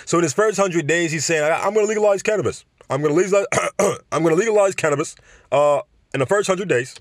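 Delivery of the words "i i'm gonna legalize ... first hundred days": put-on a higher, fast, croaky old senator voice; Bernie Sanders impression. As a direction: mimic Bernie promising to legalize cannabis, fast and high. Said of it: "when you hear me say his voice".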